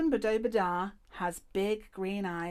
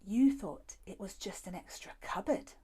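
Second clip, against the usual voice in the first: whisper. Acted. Hiding in dark cupboard.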